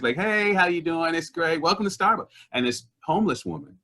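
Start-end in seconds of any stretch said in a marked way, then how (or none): 0.2-2.3 high, cheery mock customer-service greeting; super-friendly barista. Starbucks worker greeting customers, super friendly. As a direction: pitch it way up, gushing retail welcome. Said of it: "like, super friends".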